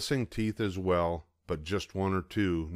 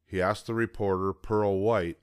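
ordinary delivery, nothing marked

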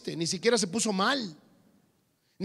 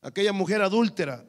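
ordinary talk

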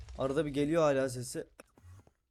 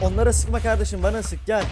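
taunting shout; 3 semitones higher, 7 dB louder than usual. Real, not acted. Taunting the Wither.

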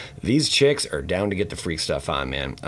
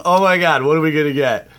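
thrilled exclaim; 9 semitones higher, 10 dB louder than usual. Real, not acted. Thrilled at pack pull.

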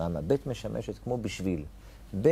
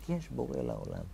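a much softer repeat of the words; soft echo; 9 dB quieter than usual. Shown as a demonstration. Teacher echoing Abraham's cry to his students, softly. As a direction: let the cry fade into an inner echo.

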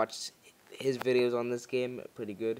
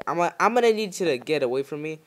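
urgent loud threat; 4 semitones higher, 7 dB louder, faster than usual. Real, not acted. Fending off monster.

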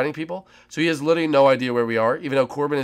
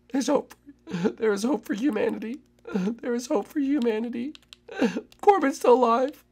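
mock dramatic hope; 10 semitones higher, 3 dB quieter than usual. Teammate still alive.

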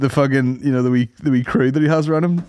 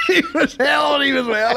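laughing repeat; 11 semitones higher than usual. Acted. Echoing with laughter.

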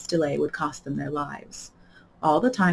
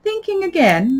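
much higher teasing question; Duchess voice; 12 semitones higher, 5 dB louder, slower than usual. A narrator's reading. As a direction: needle her playfully. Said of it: "the Duchess asked".

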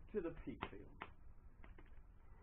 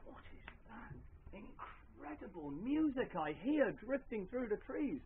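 high, drawn-out wonder; wonderstruck; 7 semitones higher, slower than usual. Acted. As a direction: marvel slowly at a mysterious sound. Enchanted character hears mysterious music.

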